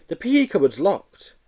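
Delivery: put-on a skinny kid asking politely; small boy.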